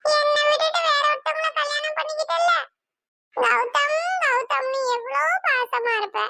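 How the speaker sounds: high-pitched lovelorn sobby whine; crying, hurt reproach; tearful whining. Acted. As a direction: whine it high like a jilted child. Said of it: "நீ ஏன் அழுகுற?".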